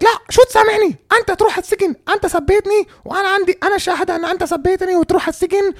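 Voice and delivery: high-pitched caricature of an angry woman; mock outraged woman. Angry woman refusing forgiveness before the police, high mock rant. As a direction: squeal the accusations in mock outrage. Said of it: "and she's like".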